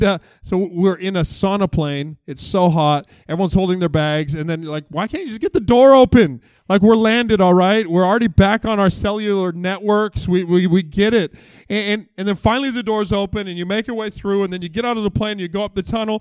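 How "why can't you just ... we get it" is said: impatient passengers. Retold or imitated. Impatient passengers at plane door.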